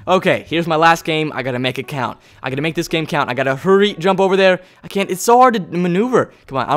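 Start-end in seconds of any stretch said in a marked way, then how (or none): none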